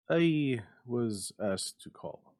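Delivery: a reluctant visitor breaking news; slow and halting messenger; visitor's voice. A narrator's reading. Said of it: "he said at last".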